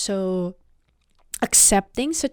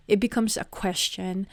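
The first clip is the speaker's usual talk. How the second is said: ordinary talk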